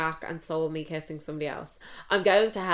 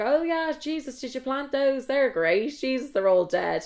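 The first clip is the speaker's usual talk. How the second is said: mock enthusiasm; 6 semitones higher than usual. Fake-enthusiastic praise of dead plants.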